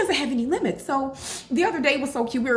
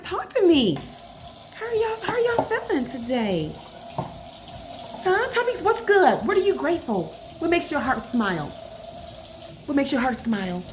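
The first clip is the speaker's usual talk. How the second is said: high-pitched calling to the viewers; 5 semitones higher than usual. Real, not acted. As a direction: call across to your audience. Calls to viewers while washing hands.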